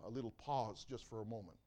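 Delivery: ordinary delivery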